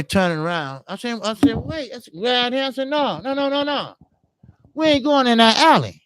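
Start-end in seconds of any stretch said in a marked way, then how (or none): 1.6-6.0 higher, pleading no no no; scared refusal. Retold or imitated. To the gunmen, refusing the alley in higher scared pleading. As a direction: beg your way out of the alley.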